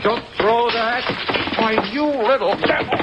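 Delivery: detective fending off the countess throwing things, alarmed; alarmed shouting. Acted. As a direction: dodge and shout at her to stop. Dodging objects she throws.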